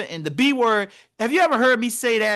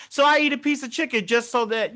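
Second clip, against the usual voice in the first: usual speaking voice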